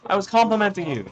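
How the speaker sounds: high-pitched, indignant self-defence; indignant protest. Real, not acted. Commentator answering being called a hater, indignant protest.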